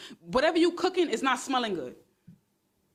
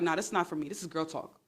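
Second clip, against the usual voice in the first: mock dismissive guy; 7 semitones lower, 5 dB quieter, faster than usual.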